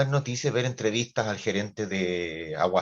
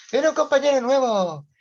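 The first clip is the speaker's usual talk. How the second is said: much higher and louder, sing it out eagerly; excited announcement; 8 dB louder than usual. Retold or imitated. Teacher hypes a new classmate to the other class, a bright high announcement. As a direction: tease the class with a juicy piece of news.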